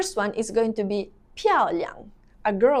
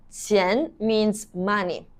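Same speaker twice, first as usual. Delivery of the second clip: ordinary delivery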